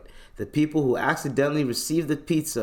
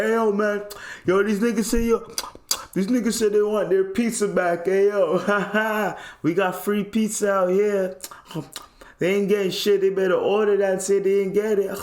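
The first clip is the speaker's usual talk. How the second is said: brash street voice calling out; mock rowdy neighbor; 8 semitones higher than usual. Voicing neighbors shouting for friends.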